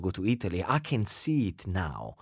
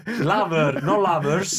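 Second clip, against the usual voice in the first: words through laughter; giggling while talking; laughing; 5 semitones higher, 4 dB louder, slower than usual. Real, not acted. Laughing over lover joke.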